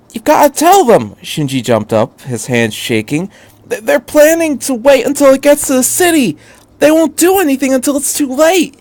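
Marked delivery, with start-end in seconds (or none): 0.1-1.2 high, louder desperate plea; pleading boy's cry. A narrator's reading. Shinji urging the doctor. 3.7-8.8 high, louder desperate plea; pleading boy's cry. A narrator's reading. Shinji urging the doctor.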